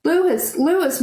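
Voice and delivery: high, frantic calling out; screaming. Acted. Girl searching the burning field, screaming for Lewis. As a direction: yell the name into the chaos. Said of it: "My screams".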